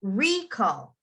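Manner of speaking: "recall" with "RE-" stressed, falling away; stress on first syllable. Shown as a demonstration. Teacher contrasting noun with verb, "recall" stressed on RE-. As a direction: hit the start of "recall". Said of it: "emphasize the beginning".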